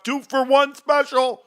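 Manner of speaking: high, slow, drawn-out sales call; mock store-sale announcer, stretched and lifted; auctioneer pitch. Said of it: "you sound like one of those dudes doing an auction".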